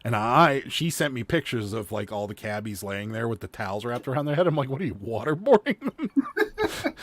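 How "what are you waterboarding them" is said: high, drawn-out incredulous question breaking into laughter; retold shock, pitched way up and laughing; amused disbelief. Reacting to photos of towel-wrapped cabbies, laughing disbelief.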